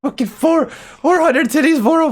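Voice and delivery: high-pitched, astonished exclaiming; incredulous excitement. Real, not acted. Reacting to another flood of alerts.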